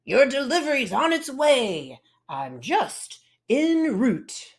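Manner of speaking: truck voice. Acted. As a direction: rumble it like a big truck. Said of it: "you'd rumble and toot".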